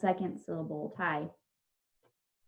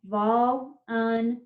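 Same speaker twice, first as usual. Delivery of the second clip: volunteer slowly, in three separate syllables; three spaced beats on volunteer; much slower than usual. Shown as a demonstration. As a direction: break volunteer into vol, un, teer. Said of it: "Three syllables".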